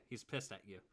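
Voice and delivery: ordinary talk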